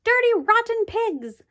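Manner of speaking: high, slow, nasty screech; screeching Sammy. A narrator's reading. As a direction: screech it at the class like a grumpy creature. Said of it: "Sammy screeched".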